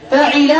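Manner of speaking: فعل said fa-'i-la, middle vowel i; kasra on ain. Shown as a demonstration. Teacher listing verb patterns, فعل as fa'ila. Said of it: "بكسر العين".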